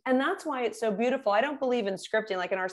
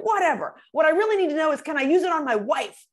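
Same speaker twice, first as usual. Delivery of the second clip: mock banker asking; 5 semitones higher, 4 dB louder than usual. Banker asks with a grin.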